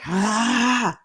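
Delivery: mock fed-up "Ah" of a tired son; mother re-enacting her son's outburst as the example; frustrated kid's yell. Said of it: "The symptom was the".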